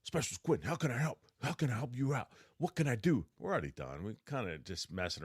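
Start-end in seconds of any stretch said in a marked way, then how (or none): 0.0-3.3 eager, high-pitched imitation of a new lieutenant; excited lieutenant. Keen lieutenant offers the specialist help, imitated excitement. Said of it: "he's all excited".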